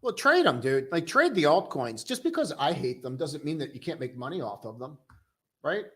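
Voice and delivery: normal talking voice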